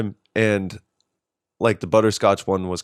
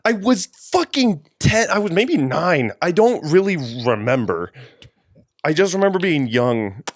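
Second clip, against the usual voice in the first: high, disbelieving self-talk; asking yourself in horror; incredulous inner voice; 9 semitones higher than usual. Retold or imitated. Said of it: "'cause I'm just like".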